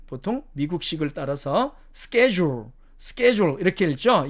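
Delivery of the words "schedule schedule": schedule said SKED-jool, with sk; American SKED-jool, clearly demonstrated; American pronunciation. Shown as a demonstration. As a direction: say schedule starting with sk, American style. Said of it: "이게 미국식 발음입니다".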